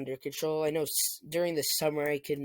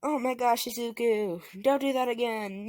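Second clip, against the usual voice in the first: lightly raised scolding mother voice; a hint of a fretting mother; worried mom; 7 semitones higher than usual. Mother Inko scolds son Izuku, mild high worry. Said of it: "Inko just like".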